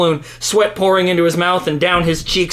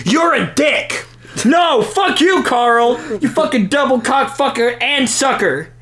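angry yelling; 7 semitones higher than usual. Acted. Escalating shouted insults.

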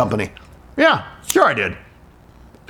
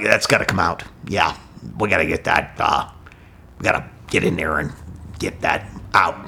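doctor; 10 semitones lower, 5 dB quieter than usual. Retold or imitated. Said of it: "so he's like".

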